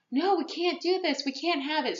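high-pitched objection; pleading retold protest. Girl begging family to spare friends, pleading retold protest.

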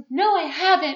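high, loud hammed-up denial; dramatic mock protest. Girl denying to boyfriend she changed, dramatic mock protest. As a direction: protest it like a soap-opera quarrel. Acted lovers' quarrel, drama.

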